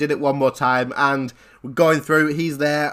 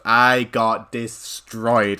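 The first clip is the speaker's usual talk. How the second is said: "destroyed" drawn right out, syllable by syllable; dramatic emphasis; 2 semitones lower, much slower than usual. Retold or imitated. Player telling how the fight went, "destroyed" dragged out dramatically. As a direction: stretch "destroyed" very slowly for full impact.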